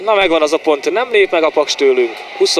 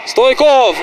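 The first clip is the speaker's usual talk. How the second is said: excited call; 9 semitones higher, 3 dB louder than usual. Real, not acted. Commentator calls out a chance.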